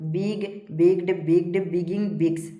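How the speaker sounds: evenly spaced word-by-word list; steady classroom drill; verb drill recitation. Shown as a demonstration. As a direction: recite each form separately for pupils to memorise. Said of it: "इस प्रकार से सारा को पढ़ते जाना है".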